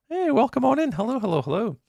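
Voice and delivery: usual speaking voice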